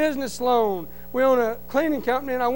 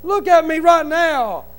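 higher, louder, slow desperate pleading; begging; 4 semitones higher, 6 dB louder than usual. Retold or imitated. To the banker who won't look, slow loud pleading. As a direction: beg him to see who you are now. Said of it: "I'm like".

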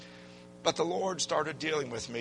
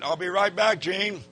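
higher and louder, bluffing brightly; forced cheerful reply; 7 semitones higher, 6 dB louder than usual. Retold or imitated. Sweating new plumber bluffs to Gene, higher and louder. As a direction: fake a breezy "no problem". Nervous cover to coworker.

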